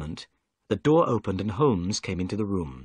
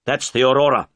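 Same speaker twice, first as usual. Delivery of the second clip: louder, urgent cry; cried out; 7 dB louder, faster than usual. A narrator's reading. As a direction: call out the boat as you spot it. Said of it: "cried Holmes".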